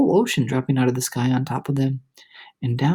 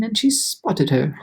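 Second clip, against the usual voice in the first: ordinary delivery, nothing marked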